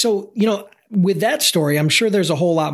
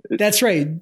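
usual speaking voice

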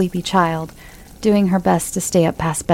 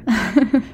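light laugh; 4 semitones higher than usual. Acted. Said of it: "He laughed".

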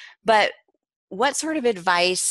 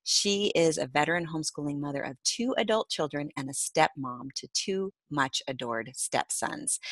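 ordinary delivery

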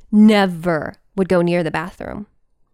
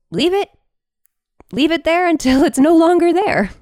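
high, careless brush-off voice; dismissive locals; 8 semitones higher, 3 dB louder than usual. Retold or imitated. Locals shrugging off the dead whale, breezy and high. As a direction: wave it off, higher and breezy.

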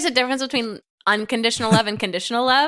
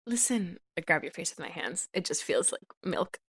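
softly murmured, much softer; hushed; 5 semitones lower, 12 dB quieter than usual. Acted. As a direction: lean in and breathe it gently. Whispered romantic confession in improv.

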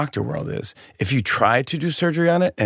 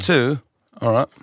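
brief, put-on British replies; British accent. American tourist answering a shopkeeper, pretend British accent. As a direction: pass yourself off as British. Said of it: "That was my accent".

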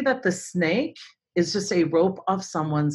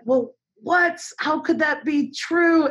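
much higher, drawn-out mock child question; imagined disbelieving kids; 10 semitones higher, slower than usual. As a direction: play a bewildered kid refusing to believe it. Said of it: "I imagine them sad".

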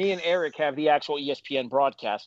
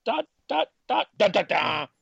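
dramatic reverb; 4 semitones higher than usual. Pretend. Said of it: "Dramatic reverb".

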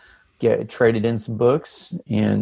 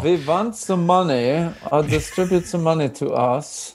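put-on German accent, pitched up; 5 semitones higher than usual. Podcast host jokes about sponsors paying up, mock German accent. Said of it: "I don't know why I went with German accent".

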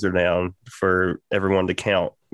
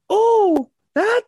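squeaky-high impression of an adorable older woman; very high-pitched woman; 6 dB louder than usual. Coworker answering the worker's question, very high-pitched imitated voice. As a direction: pipe it up very high, with a loud "oh". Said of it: "she has this very high-pitched voice".

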